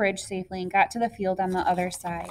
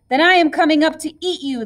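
quick, higher mock shout; raised pitch, hurried troll threat; 6 semitones higher, 4 dB louder, faster than usual. A narrator's reading. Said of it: "the troll shouted".

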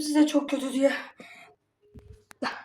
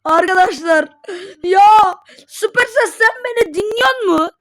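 crying out; 5 semitones higher, 9 dB louder than usual. Real, not acted. Crying over game luck.

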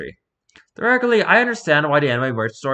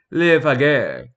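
"Les Pagures" in a thicker French accent; 4 dB louder, much slower than usual. Shown as a demonstration. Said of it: "not speaking in a French enough accent".

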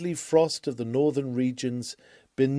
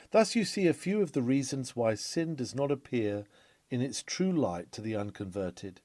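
ordinary talk

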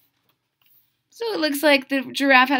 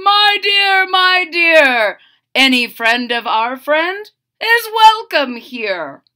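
king's voice; 4 semitones higher, 7 dB louder than usual. Acted. King replies to queen.

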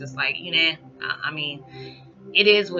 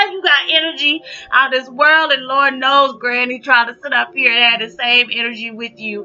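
laughing; 8 semitones higher, 8 dB louder than usual. Real, not acted. Laughing about granddaughter's energy.

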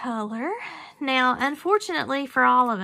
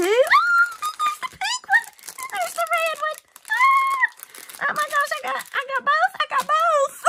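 very high, loud squeals of joy; ecstatic squealing; 7 dB louder than usual. Real, not acted. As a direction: shriek with delight at both colors. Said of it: "See, I'm out of breath".

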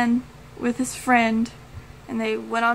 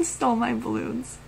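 softer, laughing through the quote; real amusement; 6 dB quieter than usual. Real, not acted. Fan quoting a funny movie line, amused laughing. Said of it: "That's why I'm laughing".

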